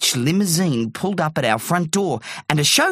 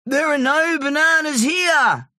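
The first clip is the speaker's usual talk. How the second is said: high-pitched character shout; high, carrying yell; 11 semitones higher than usual. A narrator's reading. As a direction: call it out high to a giant far below. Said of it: "shouted Terry".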